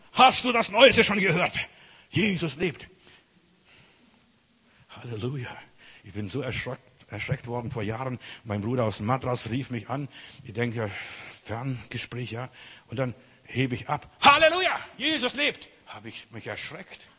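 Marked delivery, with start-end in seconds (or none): 0.1-2.9 loud, high, jubilant announcement; excited news-breaking. Acted. Acting excited phone call with news. 14.2-15.7 sudden high, blasting cry of joy; very loud jubilant shout. Retold or imitated. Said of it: "aber das ist so laut".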